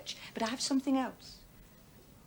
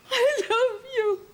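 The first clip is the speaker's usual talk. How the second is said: "I love you" slow and much higher, openly crying; sobbing; 11 semitones higher, 7 dB louder, slower than usual. Acted. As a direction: let "I love you" dissolve into tears, slowly. Wife sobbing it again.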